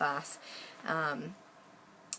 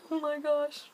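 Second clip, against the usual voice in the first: high-pitched mock awe; a delighted little squeal of words; retold amazed reaction; 10 semitones higher, 5 dB louder than usual. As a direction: gush it in a much higher voice. Retold excited reaction to pay.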